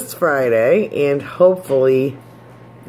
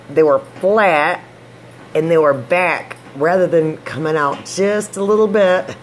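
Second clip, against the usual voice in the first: speaking with fingers on teeth; fingers in mouth; 3 semitones higher than usual. Real, not acted. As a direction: lips pulled back by fingers. Seen 2: Fingers touch teeth and pull lips while demonstrating denture position.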